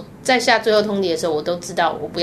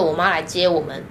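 ordinary talk

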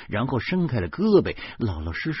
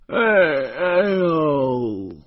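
long, loud, falling "aiyo" wail; rueful groan; 7 semitones higher, 7 dB louder, much slower than usual. A narrator's reading. Husband's bitter laugh at himself, a drawn-out wail. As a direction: draw out a high moan that slides down. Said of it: "我苦笑了几声".

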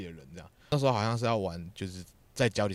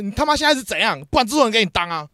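much higher and louder, raging at a junior; furious producer; 12 semitones higher, 13 dB louder than usual. Retold or imitated. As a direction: explode at the newbie in front of everyone. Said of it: "他那个口气不是你现在".

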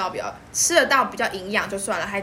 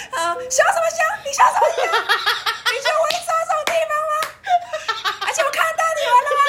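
high, crying voice scolding back; tearful scolding; faster than usual. Retold or imitated. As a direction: yell through tears at him. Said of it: "还有哭腔说". (带着哭腔高声怒骂)

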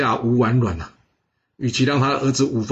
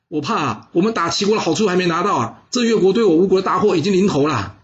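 higher, pressing warning to the king; urgent pleading minister; 7 semitones higher than usual. Acted. Wu Zixu warning King Fuchai about Yue, higher urgent plea. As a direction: beg the king to see the real danger.

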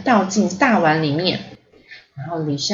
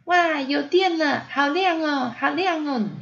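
put-on happy little girl; 8 semitones higher than usual. A narrator's reading. Ponyo jumping as the lights come on, delighted. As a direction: cheer in a high child voice. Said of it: "波妞也很高兴".

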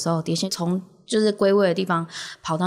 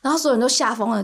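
ordinary talk

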